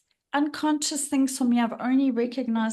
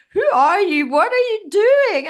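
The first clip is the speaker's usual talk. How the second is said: loud, high outburst of self-scolding; mock yell at herself; 8 semitones higher, 9 dB louder than usual. Woman on the beach yelling at herself, loud and high. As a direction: yell it at yourself in alarm. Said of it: "And I'm like".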